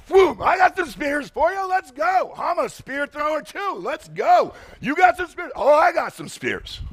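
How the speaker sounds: high, hyped-up mock challenge; eager spear thrower. Pastor mocking a churchgoer who loves fights, hyped-up high taunt. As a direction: taunt like someone itching for a fight.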